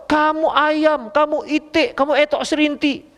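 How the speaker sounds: slow mock shouting of animal names; mock quarrel insults. Preacher voices spouses trading insults, mock shouting.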